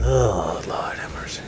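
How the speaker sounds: breathy horrified whisper. Pretend. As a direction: whisper it in mock dismay. Mock dismay at the news.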